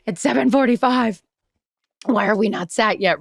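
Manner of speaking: hammed-up disgruntled diner; dramatic disgruntled complaint. Fed-up diner complains to the hostess about the table, mock disgruntled. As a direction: play up the grievance a touch. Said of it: "I'm being dramatic".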